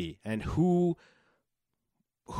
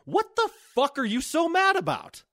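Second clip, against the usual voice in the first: exasperated. Real, not acted. Rant at angry men.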